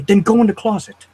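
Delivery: high, loud imitation of a bossy villain; condescending order. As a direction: order a kid about, as if superior. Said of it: "talking like he's better than someone".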